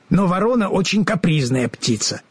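plain reading voice

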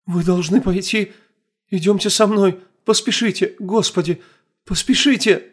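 high, frantic begging to hurry; desperate terrified urging; panicked pleading. Acted. Frightened woman urging agents on.

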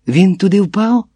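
high, loud, urgent questioning; yelling. A narrator's reading. Woman yells at men who left him in the manhole, yelling. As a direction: yell the questions, speeding up. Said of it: "горлаю я".

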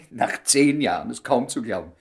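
loud, high, laughing through the words; laughing disbelief. Acted. Man laughing in joy that she still loves him. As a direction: laugh out your happy disbelief.

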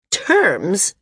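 incredulous rising question. A narrator's reading. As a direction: throw the word back in disbelief. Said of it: "His tone was incredulous".

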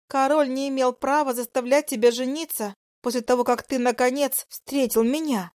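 high, pleading female voice; seductive mistress. Acted. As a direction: press your claim on him.

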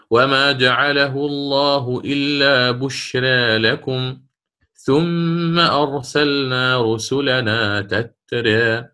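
slow, chanted Quranic recitation. Shown as a demonstration. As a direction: draw out each vowel in tajweed chant. Recites the three example verses. (تلاوة مرتلة بطيئة)

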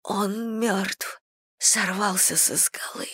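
soft, strained report of a death; weak, hushed voice. A narrator's reading. Joan telling William of the fall, weak and hushed. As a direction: say it faintly, drained of strength. Joan gasps out terrible news.

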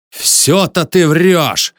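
high, drawn-out angry yell; outraged, stretched-out shout; furious accusation. A narrator's reading. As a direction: throw the lie back slowly and loudly. Enraged accusation in a story quarrel.

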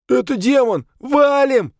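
high, loud panicked yell; panicked shout. A narrator's reading. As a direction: scream it and run. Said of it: "от шока отошёл". (в панике кричит)

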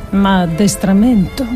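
normal talking voice

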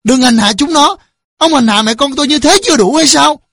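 high, loud desperate pleading; wronged wife's loud reproach; anguished protest. A narrator's reading. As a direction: cry out to shield the children. Mother begs violent husband to stop.

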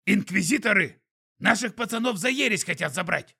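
breathless high skunk voice; urgent panting report. A narrator's reading. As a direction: blurt it out out of breath. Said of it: "задыхаясь, выпалил пук".

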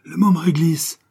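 "Le môme Réglisse" cried out high and loud; joyful cry. Acted. Garçon reconnaît son ami et crie "Le môme Réglisse" de joie. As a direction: shout "Le môme Réglisse" with delight. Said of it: "s'écria Jeannot".

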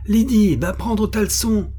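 appel perçant, bien plus aigu et fort; crié à tue-tête. A narrator's reading. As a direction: appelle sa sœur à pleins poumons. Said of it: "cria à tue-tête le gamin". (le gamin hurle à sa sœur à travers la maison, à tue-tête)

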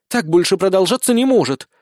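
high-pitched protest; indignant. A narrator's reading. Small blonde complains about the sergeant, indignant. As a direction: protest that enough is enough. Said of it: "возмутилась Безос".

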